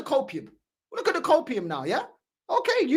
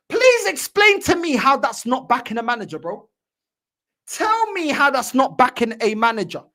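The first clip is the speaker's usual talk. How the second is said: ordinary delivery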